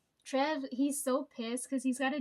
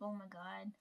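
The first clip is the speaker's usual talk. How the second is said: exasperated murmur; 4 semitones lower, 13 dB quieter than usual. Retold or imitated. Reacting to workplace chaos.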